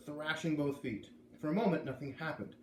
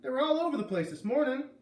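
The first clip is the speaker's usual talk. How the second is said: high, louder protest; exclaiming; 10 semitones higher, 5 dB louder than usual. A narrator's reading. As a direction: exclaim it, pitched up high and loud. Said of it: "Curly had exclaimed".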